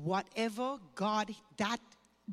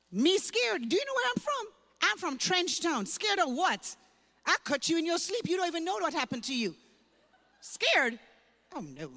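scoffing and defiant, a little higher and louder; tough mocking retort; 6 semitones higher, 4 dB louder than usual. Teen girl scoffing at a churchgoer who calls her scared, defiant. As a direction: throw her fear back at her like a street kid from Trench Town.

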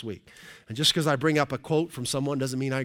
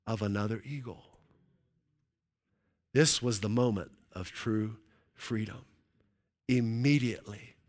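lower, softening storytelling with long pauses; hushed story climax; 5 semitones lower, 6 dB quieter, slower than usual. Acted. Preacher reads the eagle's moment of freedom, hushed storytelling. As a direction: let each phrase sink and fade in awe.

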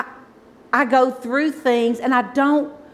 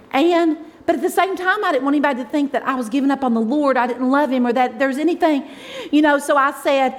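normal talking voice

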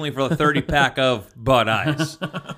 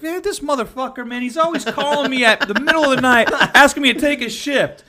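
much higher, aggrieved rant; hammed-up fed-up coworker; griping coworker; 9 semitones higher than usual. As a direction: vent about the late-night calls. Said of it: "he's like".

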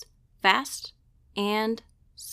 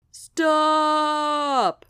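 a long, loud howl of stop; 5 semitones higher, 5 dB louder than usual. Acted. Sloth howls at Sasha mid-race, long and loud. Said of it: "howled Mister Sloth".

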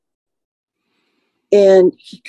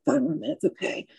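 much softer, barely voiced repeat; wounded woman in the ambulance echoes the paramedic, faintly; faint and weak; 5 semitones lower, 13 dB quieter than usual. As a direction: hold on to the words with fading strength.

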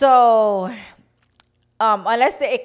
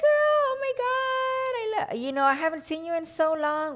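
very high, squealing delight; overjoyed to finally see her friend; gushing fan girl; 12 semitones higher than usual. Retold or imitated. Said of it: "and she was like".

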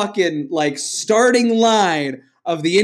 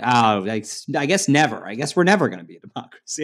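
much lower, quick deflated resignation; mock defeated shrug; 8 semitones lower, 4 dB quieter, faster than usual. The country giving up on democracy after Reagan, lower and deflated.

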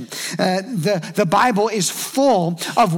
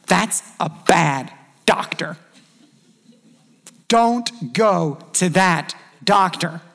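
slow warning; slower than usual. Shown as a demonstration. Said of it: "What would we say about that doctor?".

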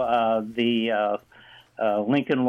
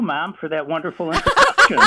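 laughing; 5 semitones higher, 7 dB louder than usual. Real, not acted. Joking thanks to host.

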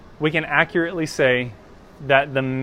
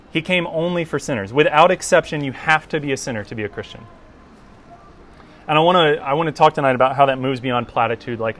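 ordinary talk

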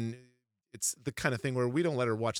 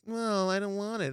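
high-pitched imitation of a refusing kid; whiny child; 9 semitones higher than usual. Daughter refusing her dinner, high whiny mock child. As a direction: protest in a high little kid's voice.

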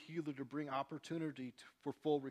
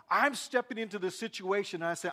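loud, high, fervent preaching; full-force preaching; 7 semitones higher, 13 dB louder than usual. Acted. Pastor preaching loud to the congregation about healing. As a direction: preach it out to the whole room.

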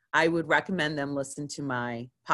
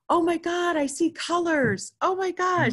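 much higher, thrilled and amazed; excited; 13 semitones higher than usual. Retold or imitated. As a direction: squeal it out in wonder. Client's amazed reaction retold.

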